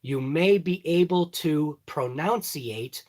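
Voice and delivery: slow and deliberate, every word separate; slow, careful speech. Shown as a demonstration. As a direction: think through each word as you say it. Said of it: "if I talk like that and talk every word".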